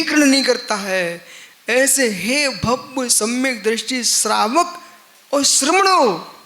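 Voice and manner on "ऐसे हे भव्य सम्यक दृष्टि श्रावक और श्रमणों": slow, raised call to the faithful; preaching address. Real, not acted. Preacher hails the devout listeners, slow ringing address. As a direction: draw out the address, pitched high.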